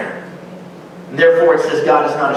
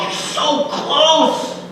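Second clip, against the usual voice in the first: high, slow, stretched-out coaxing; urging encouragement; 10 semitones higher, much slower than usual. Retold or imitated. Writer of Hebrews urging weary believers on, slow and high. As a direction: draw him the last step with a long, pleading urge. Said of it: "the writers basically encourage him".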